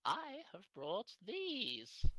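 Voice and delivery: put-on high, slow old fortune teller; Madame Eva. Old woman presents her cards, high and slow. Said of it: "She pulls out a pack".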